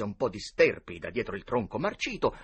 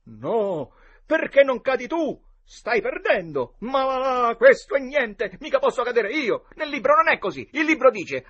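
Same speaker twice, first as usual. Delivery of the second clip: litigio tra ragazzi; 14 semitones higher, 10 dB louder than usual. Acted. Boys argue over the book.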